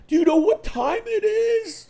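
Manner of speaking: a wife scolding at night. Retold or imitated. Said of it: "and she's like".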